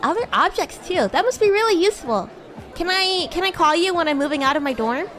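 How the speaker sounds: usual speaking voice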